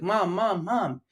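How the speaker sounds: put-on six-year-old son calling his mother; child calling mom. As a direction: mimic a kid nagging for mom. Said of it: "He's like".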